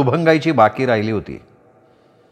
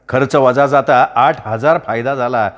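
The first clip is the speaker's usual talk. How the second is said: ordinary delivery, nothing marked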